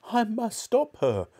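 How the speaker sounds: high, drawn-out desperate wail. A narrator's reading. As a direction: wail it helplessly. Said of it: "Missus Cranky wailed".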